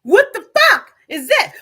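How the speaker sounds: loud, high, shocked what the fuck; shocked outburst. Retold or imitated. Vlogger reliving her first try, shocked loud cry. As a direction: blurt it out in disbelief.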